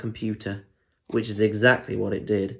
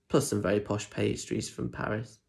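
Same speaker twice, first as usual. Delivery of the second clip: plain reading voice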